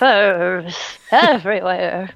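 put-on slow creaky old lady; slow, drawn-out old woman voice. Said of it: "That was the old woman voice".

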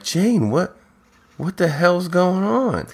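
high-pitched mock bewilderment; incredulous question, hammed up a little; shocked. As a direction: imitate a baffled friend, voice lifted. Acting out friends shocked at Jane.

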